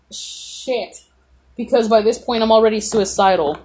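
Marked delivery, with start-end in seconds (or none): 0.1-1.0 breathy whisper of dismay; resigned whispered swear word; whispered curse. Retold or imitated. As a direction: let it fall to a whisper.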